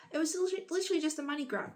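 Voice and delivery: literally spitting out the outrage; indignant outburst. Real, not acted. Indignant at doctor's money grab.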